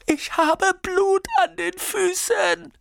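sehr hoch und laut, außer sich; hysterischer Schrei. A narrator's reading. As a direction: kreisch die Frage panisch heraus. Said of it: "schreit Nina". (schrill aufgelöst schreiend)

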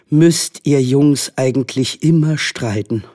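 mildly exasperated mother, slowly stressed; despairing. A narrator's reading. Mothers to their squabbling boys, despairing. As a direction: ask it in drawn-out despair. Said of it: "fragten verzweifelt".

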